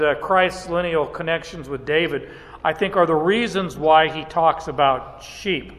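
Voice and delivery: normal talking voice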